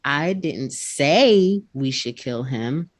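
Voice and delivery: "say" pushed up and held, slow; stress on say. Shown as a demonstration. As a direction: lean on "say" as if implying otherwise. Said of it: "he put more emphasis on one word".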